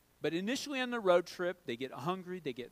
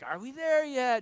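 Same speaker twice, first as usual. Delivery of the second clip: a hint of a whiny child, a bit higher and louder; kid whining; 6 semitones higher, 7 dB louder than usual. Kids in the back seat nagging dad, lightly whiny. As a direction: toss it off like an impatient kid. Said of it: "they would say".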